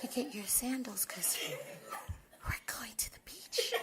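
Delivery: whispering. Pretend. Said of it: "You talk to me though".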